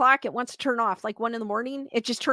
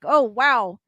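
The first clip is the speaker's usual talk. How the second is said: ordinary delivery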